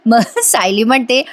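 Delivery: usual speaking voice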